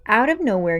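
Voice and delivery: plain reading voice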